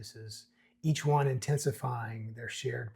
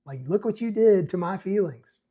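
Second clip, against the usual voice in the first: accusing mock; 5 semitones higher, 5 dB louder than usual. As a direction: lay the blame on thick. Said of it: "reflecting it to himself".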